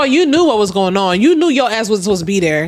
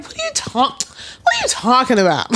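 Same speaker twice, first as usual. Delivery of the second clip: baffled what; 3 semitones higher than usual. Retold or imitated. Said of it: "you're just like".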